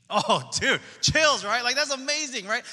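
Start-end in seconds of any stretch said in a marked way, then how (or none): none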